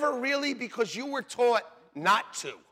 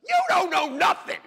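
loud, high shouted put-down; shouted rebuke; 9 semitones higher, 7 dB louder than usual. Acted. Preacher mocking know-it-all leaders, shouted rebuke. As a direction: shout them down, pitched way up.

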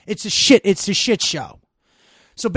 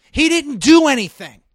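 real anger, much higher and louder; erupting in outrage at an injustice; angry outburst; 7 semitones higher, 8 dB louder than usual. Real, not acted. Ranting to a co-host about the Minnesota shooting, furious and loud. Heated rant continues.

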